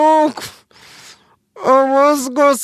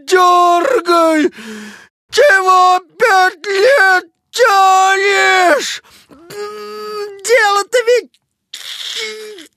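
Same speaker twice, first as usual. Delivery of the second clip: pained shouting; slower than usual. Acted. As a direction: yell it through tears of pain. Patient screaming at the feldsher.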